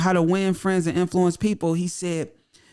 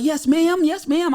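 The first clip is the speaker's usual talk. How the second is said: much higher and louder, a polite mock phone voice; 10 semitones higher, 6 dB louder, slower than usual. Mocking his own phone voice to a caller, high and polite. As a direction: put on your sweet high customer-call voice. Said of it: "I got a high-pitched voice".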